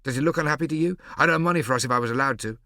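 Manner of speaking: put-on heated boy's protest; defiant boy. A narrator's reading. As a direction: push back fast and hurt. Prosper keeps defending himself.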